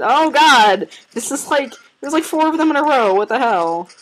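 high, loud, fast rising panic; startled alarm. Real, not acted. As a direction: blurt it out in shock. Surprised by rare creatures appearing.

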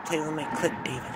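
softly pleading female voice; woman's voice. Retold or imitated. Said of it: "And she's like".

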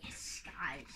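soft unvoiced whisper. Real, not acted. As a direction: breathe it out quietly. Hushed aside to viewers.

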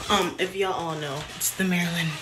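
ordinary talk, nothing marked

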